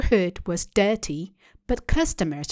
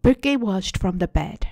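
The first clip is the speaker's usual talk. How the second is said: ordinary delivery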